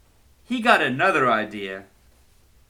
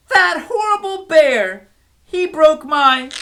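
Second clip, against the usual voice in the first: loud, high upset rant; angry complaint; 10 dB louder than usual. Acted. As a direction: vent to the stuffy in a rage. Girl complains to her stuffy.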